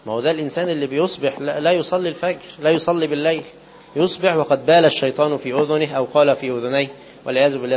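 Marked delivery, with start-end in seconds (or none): none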